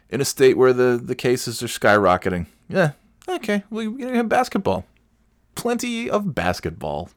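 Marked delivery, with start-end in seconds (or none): 3.3-7.1 very high mock-cheerful okay; sarcastic. Real, not acted. Mocking the basketball return, high sarcastic cheer. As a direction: fake enthusiasm, squeaked up high.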